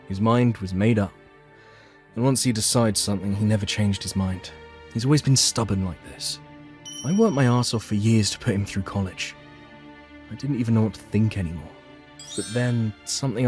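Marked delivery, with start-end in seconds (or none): none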